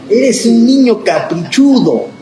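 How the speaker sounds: a hint of a scolding mom; scolding mother. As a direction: play the nagging grandmother. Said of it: "nuestras mamás y nuestras abuelas usaban".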